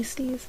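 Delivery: big high sneeze. Real, not acted. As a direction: let out a sudden sneeze. Real sneeze after sniffing soap.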